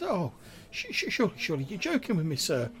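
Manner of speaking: high, quick nervous stammer; Grimwald, squeaky. Acted. Incredulous sprite thinks the guest is joking, squeaky stammer. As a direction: stammer in high-pitched disbelief, easing down. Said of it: "in, like, a really, like, squeaky, high-pitched voice".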